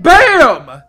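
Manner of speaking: explosive shouted punchline word; sudden loud exclamation. Retold or imitated. Storyteller springing the surprise at the grill, loud exclamation. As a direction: hit it hard and loud.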